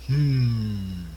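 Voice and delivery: loud, drawn-out falling hum; long, loud "hmm" sliding downward; pointed hmm. Acted. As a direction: hum knowingly, in character. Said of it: "Boris is just gonna go".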